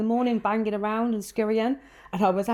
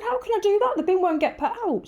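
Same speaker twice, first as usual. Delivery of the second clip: high incredulous protest; 14 semitones higher than usual. Retold or imitated. Retold own panicked protest.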